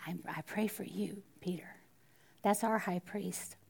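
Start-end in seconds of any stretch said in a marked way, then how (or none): 0.1-1.8 soft intimate whisper; tender whisper. Retold or imitated. Jesus whispering reassurance to Peter. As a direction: whisper it like a secret promise. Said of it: "But he says".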